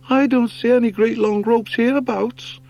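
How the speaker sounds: high doubting hare voice; doubtful young hare. Acted. Hare objects there are no ropes, high doubtful voice. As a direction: raise the objection.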